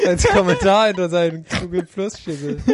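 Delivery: words broken by laughter; laughing while talking. Real, not acted. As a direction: laugh through the sentence. Laughing at own joke.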